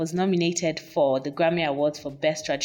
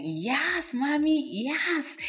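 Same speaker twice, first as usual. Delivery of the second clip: much higher, delighted squeal; excited approval; 10 semitones higher, much slower than usual. Retold or imitated. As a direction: gush with delight. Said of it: "So that line was like".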